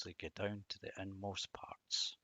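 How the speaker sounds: breathy whisper on the verse's last line. Acted. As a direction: whisper it like a secret being passed on. Reading verse about gossip.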